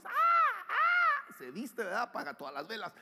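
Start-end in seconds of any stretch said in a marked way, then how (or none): 0.0-1.3 loud, very high mock screams. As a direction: scream shrilly in staged alarm. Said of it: "empieza a pegar de gritos".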